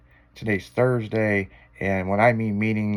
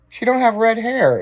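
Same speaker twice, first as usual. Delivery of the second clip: much higher and louder put-on squeal; mock protest, pitched way up; mock objection; 12 semitones higher, 6 dB louder than usual. Mock-retorting her own reply.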